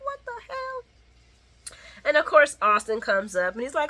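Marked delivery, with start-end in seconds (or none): 0.0-1.7 incredulous hiss. Retold or imitated. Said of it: "the Jerome kicked in".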